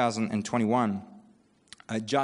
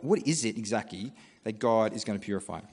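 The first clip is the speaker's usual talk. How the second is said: normal talking voice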